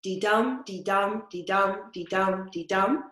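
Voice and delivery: five even dee-dums, stress on each dum; iambic beat. Shown as a demonstration. Teacher chanting the five feet for the student. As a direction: count the beat out steadily. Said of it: "But that rhythm".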